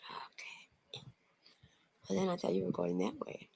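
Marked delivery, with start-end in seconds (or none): 0.1-0.6 very soft hushed whisper. Real, not acted. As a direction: breathe it out in a whisper. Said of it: "Why are you whispering?".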